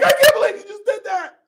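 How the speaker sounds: very high, loud shocked shout; imitating a mother screaming in disbelief; shocked shriek. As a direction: shriek it as the horrified mom. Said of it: "The mom is like".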